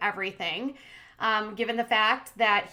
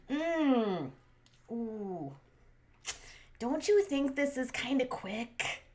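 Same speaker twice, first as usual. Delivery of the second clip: lightly imitated, drawn-out Russian-accented hesitation; cute Russian accent; 2 semitones higher, 4 dB quieter, slower than usual. Vlogger retelling the girlfriend's reply to the proposal, mock Russian accent. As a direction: hum and stall in a soft, slow mock Russian accent. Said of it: "in her cute little Russian accent".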